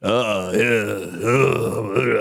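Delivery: put-on old grunty man. Shown as a demonstration. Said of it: "old grunty man".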